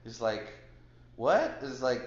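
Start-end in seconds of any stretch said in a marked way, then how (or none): 1.2-1.6 mildly startled, higher and louder; retold surprise. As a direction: play the host caught off guard. Retold host's startled reply.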